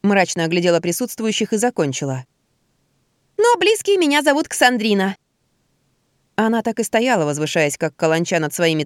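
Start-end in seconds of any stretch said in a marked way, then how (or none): 3.4-5.2 high fake voice. A narrator's reading. Disguised man's fake voice.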